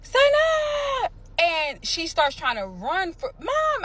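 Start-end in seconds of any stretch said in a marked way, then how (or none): 0.0-1.1 high, loud call across the street; calling out to her daughter down the block, loud and high; yelling her name. Retold or imitated. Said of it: "I yell her name". 3.4-3.8 high, drawn-out cry of Mom; a held high call; child calling mom. Retold or imitated. Daughter calling out, imitated.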